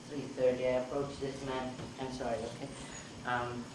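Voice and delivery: ordinary delivery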